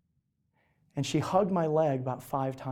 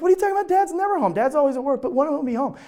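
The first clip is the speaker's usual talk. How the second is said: mocking brothers; 11 semitones higher, 4 dB louder, faster than usual. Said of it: "They're like".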